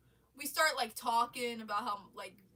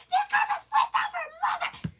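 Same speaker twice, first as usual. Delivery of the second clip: very high, loud mock shriek; re-enacted hysterical news-breaking; shrieked tattling; 11 dB louder than usual. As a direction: squeal the scandal out to mom. Shouting the news to mom.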